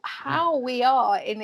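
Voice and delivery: normal talking voice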